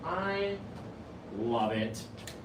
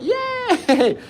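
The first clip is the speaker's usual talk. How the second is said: mock cheer; 6 semitones higher, 12 dB louder, much slower than usual. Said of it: "Ken's like".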